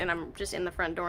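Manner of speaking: ordinary talk, nothing marked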